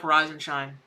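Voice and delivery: blunt tough-love reply; deadpan retort. Retold or imitated. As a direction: toss it back dryly. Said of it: "we're like".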